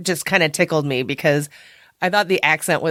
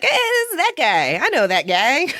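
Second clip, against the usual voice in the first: much higher squeal that breaks into a laugh; excited recognition; 8 semitones higher than usual. Retold or imitated. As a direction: point at the screen in delight.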